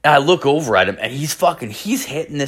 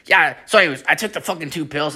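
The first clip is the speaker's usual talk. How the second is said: imitating wired friend; 6 semitones higher, faster than usual. Wired friend resumes his story.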